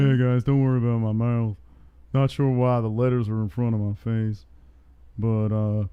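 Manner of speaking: lightly voiced fighter impersonation; Derek Lewis. Streamer playing a fighter addressing viewers about his mouth, light impersonation. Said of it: "when I do my impersonation".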